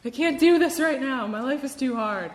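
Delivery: high, loud despairing cry of frustration; overwhelmed cry of I can't do this; exasperated outburst. Retold or imitated. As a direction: blurt it out like you're at the end of your rope. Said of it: "and I was like".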